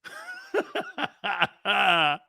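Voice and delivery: long laugh at oneself; amused, rolling laugh; real laughter. Real, not acted. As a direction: crack up after the silly voice. Laughing at the Mickey Mouse voice.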